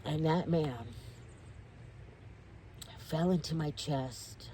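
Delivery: subdued, slowing, real emotion; quietly moved, with long pauses; emotional. Real, not acted. Storyteller recalling the man falling into her chest, emotional. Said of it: "I'm getting emotional".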